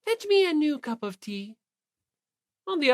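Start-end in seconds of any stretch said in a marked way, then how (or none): 0.0-1.6 high, sweet-voiced command; smiling order. Celeste ordering Yamada to bring tea, sweetly imperious. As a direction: ask sweetly but expect obedience. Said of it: "ordered Yamada with a smile".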